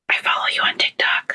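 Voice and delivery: whispered confession in the OR; operating room tech whispering to the new mom. Retold or imitated. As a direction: lean in and whisper it secretly.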